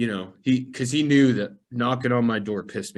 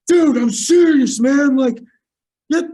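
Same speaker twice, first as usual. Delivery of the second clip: mock shout; 9 dB louder than usual. Retelling yelling at the pestering roommate, high and loud. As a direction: yell him away from the door. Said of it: "I'm like".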